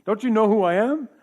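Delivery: entitled pastor. Retold or imitated. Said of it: "entitled somehow".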